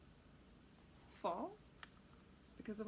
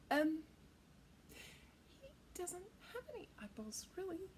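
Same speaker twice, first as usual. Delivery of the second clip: usual speaking voice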